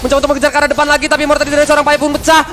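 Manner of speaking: high, loud hype play-by-play; esports caster. Acted. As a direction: call the teamfight at full excitement. Excited play-by-play during teamfight.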